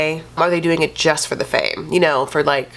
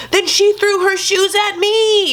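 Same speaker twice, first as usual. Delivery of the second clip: much higher, slow, whiny mock complaint; mock Jesse complaining; 13 semitones higher than usual. Mimicking boyfriend's complaint about thrown shoes, high slow whine. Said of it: "Then he's like".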